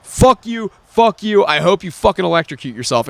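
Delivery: re-enacted exasperated rant; furious parting tirade. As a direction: keep cursing them out as you storm off. Retold parting tirade at motel staff.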